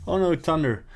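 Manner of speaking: boy caught out by thunder, mock fright; startled yelp. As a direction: jump at the thunderclap.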